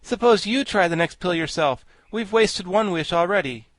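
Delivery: put-on indignant boy, moderately heated; angry retort. A narrator's reading. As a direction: throw it back crossly. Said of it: "retorted Tip angrily".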